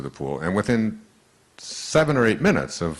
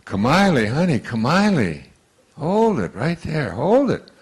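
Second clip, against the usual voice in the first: loud soothing; 8 semitones higher, 6 dB louder than usual. Retold or imitated. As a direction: hold the child off loudly. Calming the sobbing child.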